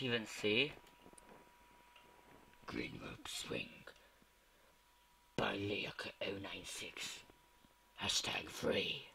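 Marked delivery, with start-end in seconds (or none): 2.7-9.1 whispered title read, quick and breathy; level reading whisper. Acted. Gamer whispering the level description to viewers.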